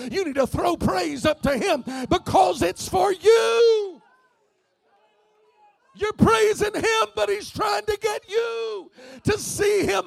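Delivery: loud, high shouted preaching; full-force preaching. Real, not acted. Pastor declaring he will not fear to the congregation, full-force preaching. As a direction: build to a fervent, ringing shout of praise.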